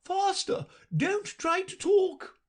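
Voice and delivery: very high, slow queen cry. A narrator's reading. Queen silences Alice while running, high cry. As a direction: order her on without pause. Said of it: "for she cried".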